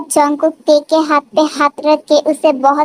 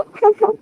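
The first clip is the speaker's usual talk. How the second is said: little giggle; 6 semitones higher than usual. Acted. Couple laugh together.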